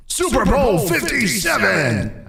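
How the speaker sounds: high, slow booming play-by-play call; sports announcer shout. Pretend. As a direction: call it like a championship win on TV. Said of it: "It's like Dallas just won the Super Bowl".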